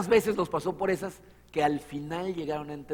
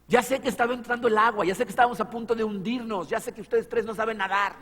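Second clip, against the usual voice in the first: emphatic reply; 7 semitones higher, 4 dB louder than usual. Retold or imitated. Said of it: "Jesucristo seguramente hubiera contestado".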